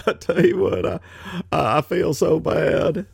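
a hint of a syrupy Clinton sympathy voice; Bill Clinton impression. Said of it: "I can do a Bill Clinton imitation".

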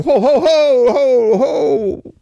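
high, loud nervous ho ho ho; nervous laugh. Pretend. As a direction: laugh it off, flustered and backing down. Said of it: "start waving your hands going".

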